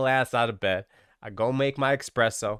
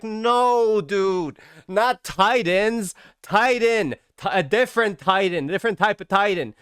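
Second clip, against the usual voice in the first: mock exasperated Minfilia; 6 semitones higher, 3 dB louder, slower than usual. Minfilia correcting him, voiced higher.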